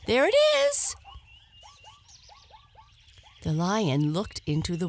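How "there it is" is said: very high, loud hare voice. Acted. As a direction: triumphantly show the lion his rival. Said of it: "said the hare".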